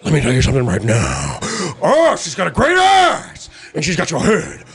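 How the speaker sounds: a hammed-up Pacino rant; generic Pacino. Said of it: "everybody does the".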